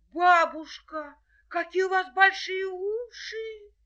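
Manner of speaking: put-on high, loud amazed girl; wide-eyed little girl. A narrator's reading. Girl asking granny.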